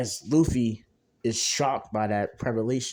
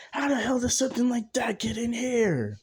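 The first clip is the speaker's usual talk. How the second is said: shocked high cry; 12 semitones higher than usual. Acted. Luffy shocked by giant being.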